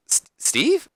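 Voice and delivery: high stammered question; Deborah. Acted. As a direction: falter on the name, leap up high. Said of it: "And Deborah's like".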